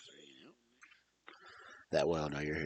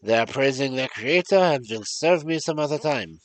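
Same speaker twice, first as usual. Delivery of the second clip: storytelling in a put-on French-German accent; muddled French-German accent; 3 semitones higher, 5 dB louder than usual. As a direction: read the tale with a hammy continental accent, French sliding into German. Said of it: "French accent when this is supposed to be German".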